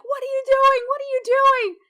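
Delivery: high, loud half scream at the screen. Retold or imitated. Player yelling at screen as Velen attacks, half scream. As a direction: yell it at the monitor in disbelief. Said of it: "I was half screaming into my screen".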